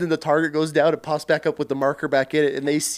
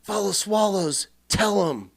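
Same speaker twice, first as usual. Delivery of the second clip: drill instructor; 4 semitones higher, 4 dB quieter than usual. Retold or imitated. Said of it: "and he's like".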